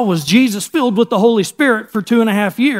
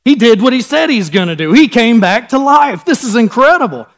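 raised, energetic retelling of her joy; retold overjoyed excitement; 4 semitones higher, 4 dB louder than usual. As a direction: voice her amazement, higher and louder. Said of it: "overjoyed that he's alive".

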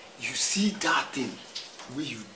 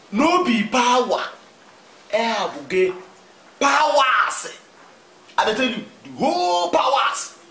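slow, high and loud, hammering each word; emphatic shout; 9 semitones higher, 6 dB louder, slower than usual. Acted. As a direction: shout it down as final. Heated boast about Ahab's power.

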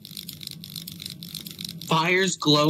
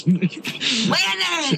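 exasperated shout; 10 semitones higher than usual. Real, not acted. Scolding friend who looked away.

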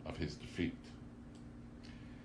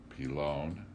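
usual speaking voice

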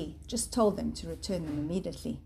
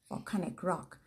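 ordinary delivery, nothing marked

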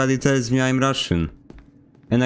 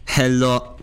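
slow, thick Polish accent, pitched up; 4 semitones higher, slower than usual. Shown as a demonstration. To his gaming friends, showing off his Polish accent. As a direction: lean hard into a Polish accent, drawn out. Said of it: "my Polish accent just goes full".

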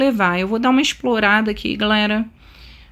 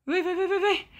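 ordinary talk

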